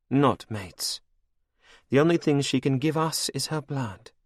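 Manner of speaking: high, cold and mocking; villainess queen. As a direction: purr it over your captive like a cruel queen. Anne scorns humans.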